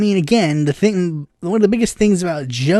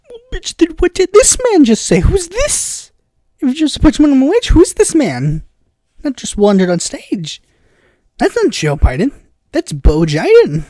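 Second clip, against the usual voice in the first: high-pitched incredulous rant; mock outrage; 6 semitones higher than usual. Viewer reacting to Biden contradicting his ads, incredulous. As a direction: sputter in comic disbelief.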